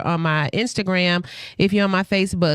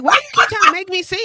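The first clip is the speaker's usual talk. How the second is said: her own retold whine, much higher and a bit louder; high-pitched mock complaint; high mock protest; 11 semitones higher, 4 dB louder, faster than usual.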